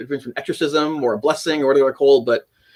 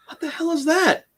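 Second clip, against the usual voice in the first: shock; 11 semitones higher, 3 dB quieter than usual. Retold or imitated. Seeing bleeding scratches in mirror.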